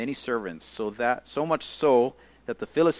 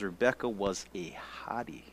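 whispered aside; 4 semitones lower, 9 dB quieter than usual. Acted. Joking aside slipped into the reading.

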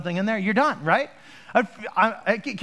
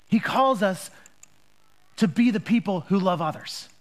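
preaching; 3 semitones higher than usual. Acted. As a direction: lean into each question with pauses.

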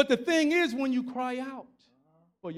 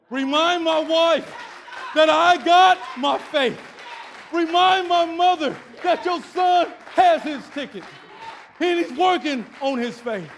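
raised, declaiming sermon voice; impassioned preaching; 8 semitones higher, 10 dB louder than usual. Real, not acted. Preacher leaving word for wife and mother, impassioned preaching. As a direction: proclaim it in emphatic, rolling phrases.

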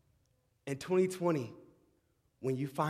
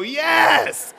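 much higher, much louder church shout; shouting praise; 21 dB louder than usual. Pretend. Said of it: "I wanted to go".

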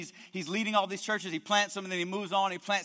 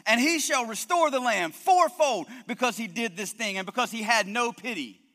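angry; 5 semitones higher, 5 dB louder than usual. Acted. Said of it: "He's, he's angry".

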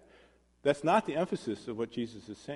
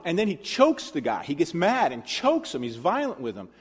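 ordinary talk

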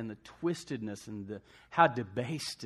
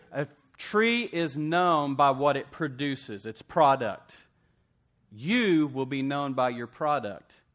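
loud, slow, emphatic preaching; full-force preaching; 2 semitones higher, 6 dB louder than usual. Acted. Preacher pressing the point to the congregation, loud and emphatic. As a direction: hammer each phrase home to the church.

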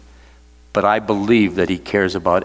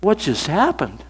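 higher-pitched bewildered question; retold baffled reaction, pitched up; dumbfounded; 8 semitones higher than usual. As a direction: mimic stunned players asking in disbelief. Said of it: "we were all dumbfounded".

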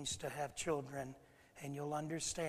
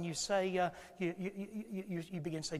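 ordinary talk

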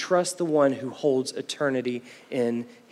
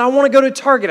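put-on high, louder whiny little-girl voice; whiny child; 9 semitones higher, 10 dB louder, much faster than usual. Four-year-old daughter demanding Target from dad, whiny and high. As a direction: whine it like a four-year-old who won't give up.

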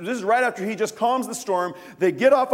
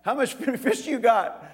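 friendly call to fishermen; 4 semitones higher, slower than usual. Acted. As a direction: call out cheerfully and chuckle at them. Jesus voiced calling to fishermen.

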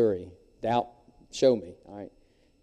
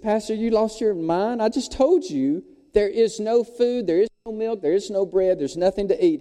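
exasperated protest; 7 semitones higher, 3 dB louder than usual. Acted. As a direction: throw up your hands at the pastor. Cooks protest in disbelief.

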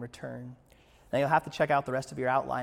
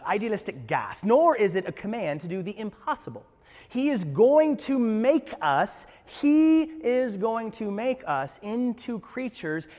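put-on British accent; 6 semitones higher, 9 dB louder than usual. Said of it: "say it in the British accent".

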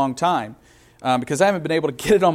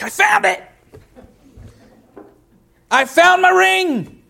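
much higher, joyful cry of "I found my ring!"; overjoyed cry; 4 dB louder than usual. Retold or imitated. On the phone to his wife Sarah, overjoyed and high. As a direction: shout the news through happy tears. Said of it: "I was like".

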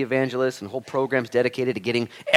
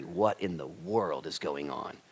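ordinary delivery

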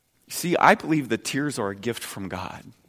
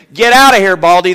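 mock taunt, louder and higher; jeering boys; 6 semitones higher, 12 dB louder than usual. Preacher acting out boys taunting Elisha, loud mock jeer. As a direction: jeer it like a mocking kid. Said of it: "some boys came out of the town and jeered at him".

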